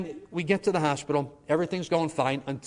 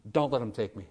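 ordinary talk

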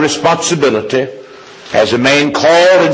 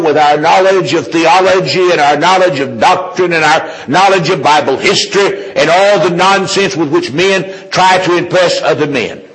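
raised-pitch, insistent sermon delivery; forceful preaching; 7 semitones higher than usual. Real, not acted. Preacher denouncing knowledge used to impress men, high forceful preaching. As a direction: drive the point home in high, emphatic phrases. Preaches against showy learning.

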